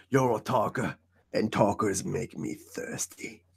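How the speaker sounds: low, slow, hushed menacing character voice; a slow, quiet, threatening line; quoting a movie line. Pretend. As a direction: drop low and drag it out like a villain. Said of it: "sorry, I had to do that".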